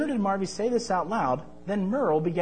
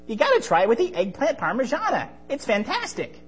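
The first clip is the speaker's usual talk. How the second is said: very high, squeaky cartoon friend; a bright high-pitched sidekick; 6 semitones higher than usual. Merle's character voice.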